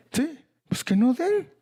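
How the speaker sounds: bright cry of agreement from the pulpit; high exclaimed echo. Real, not acted. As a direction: toss the words up in triumph. Echoes God's reply, exclaimed.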